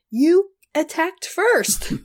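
high, slow indignant protest; indignant retort. Retold or imitated. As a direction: stretch it out, indignantly. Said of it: "And my thought was".

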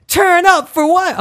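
high, stretched hype yell; mock party shout. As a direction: imitate her chant, loud and drawn out. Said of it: "She walks around the house going".

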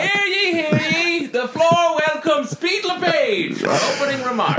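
booming town crier proclamation. Pretend. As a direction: announce it like a herald. Mock parliament announcing Pete.

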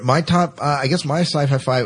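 usual speaking voice

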